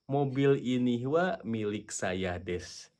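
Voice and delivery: slow, deliberate dictation; slow model sentence. Shown as a demonstration. Teacher showing the reversed sentence slowly. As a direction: say it slowly, word by word. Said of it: "Topiknya adalah".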